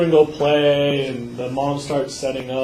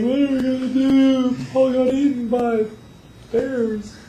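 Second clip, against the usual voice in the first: mock despair; 7 semitones higher than usual. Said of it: "the lady's like".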